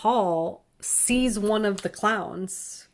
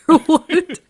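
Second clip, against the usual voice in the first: laughing it out, much higher and louder; 10 semitones higher, 12 dB louder than usual. Real, not acted. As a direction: break into a laugh on the joke. Joking about the movie crew.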